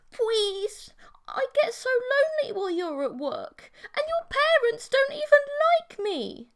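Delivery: whiny begging. Acted. Emily begging him to quit.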